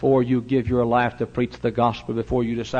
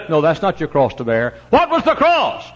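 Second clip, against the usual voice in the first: ordinary talk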